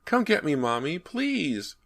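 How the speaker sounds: put-on high child's voice, stretched slow on please; begging little boy. A narrator's reading. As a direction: plead for mommy to come.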